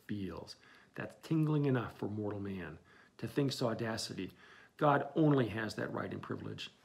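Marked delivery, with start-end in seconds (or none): 0.1-0.5 "feels." much softer, dying away; fading repeat. Acted. Captain's repeated word fading out, soft and dying away. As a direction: let "feels." trail off to almost nothing. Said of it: "He only feels".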